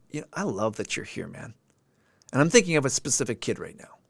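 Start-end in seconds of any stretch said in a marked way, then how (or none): 0.3-1.5 sincere affection. Retold or imitated. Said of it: "the genuine sincerity".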